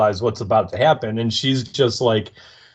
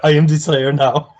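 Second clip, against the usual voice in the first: mock movie hijacker, a bit of accent; Captain Phillips parody; 3 semitones higher, 4 dB louder than usual. Co-host echoes the joke about Riley, Captain Phillips parody. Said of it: "a good Captain Phillips reference".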